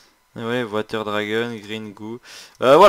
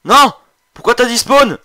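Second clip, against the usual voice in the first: raging gamer; 12 semitones higher, 16 dB louder than usual. Real, not acted. Boss despawns, he rages.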